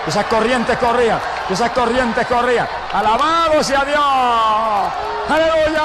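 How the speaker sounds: high, urgent testimony building into shouted praise; fervent Pentecostal preacher at high pitch; impassioned preaching. Real, not acted.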